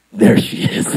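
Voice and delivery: voiceless, hushed sighting; conspiratorial whisper; stakeout whisper. Retold or imitated. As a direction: whisper it like spotting prey. Spotting the girl from the car.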